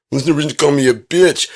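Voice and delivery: high, loud wounded protest; hammed-up hurt objection; mock offended. Acted offended reply in imagined quarrel.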